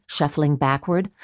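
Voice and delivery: plain reading voice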